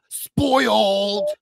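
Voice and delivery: sharp gasp, then a high, loud wail; mock outraged cry. Host mimicking people upset the next day, mock outraged cry. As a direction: imitate a scandalized viewer crying out. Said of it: "people the next day like".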